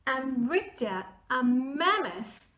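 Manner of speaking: slow the build, then explode the last word; building to a blast. A narrator's reading. Narrator building to the mammoth fart, ending in a shout.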